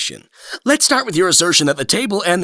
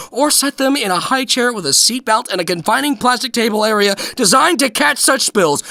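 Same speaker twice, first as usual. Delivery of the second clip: ordinary talk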